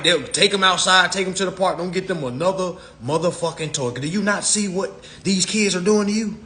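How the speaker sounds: ordinary talk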